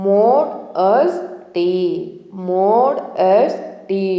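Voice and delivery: "మోడెస్టీ" very slow, mo-des-ti pulled apart; split into syllables. Shown as a demonstration. Teacher breaks the word apart slowly for students. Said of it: "అని పలకాలి".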